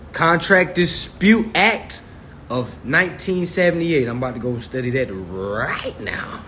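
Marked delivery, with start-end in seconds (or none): 4.1-6.4 whispered aside; a whispered promise to himself. Real, not acted. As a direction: breathe it out as a private aside.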